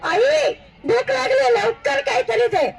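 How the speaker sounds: put-on high-pitched hungry boy calling mum; storyteller's high, mild kid yell; mock child's yell. A narrator's reading. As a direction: call out for food from another room, without real volume. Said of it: "तिथनंच ओरडून आईला".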